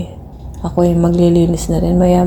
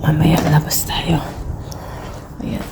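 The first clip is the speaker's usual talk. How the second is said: whisper. Real, not acted. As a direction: whisper it like a little secret. Whispering a plan to viewers.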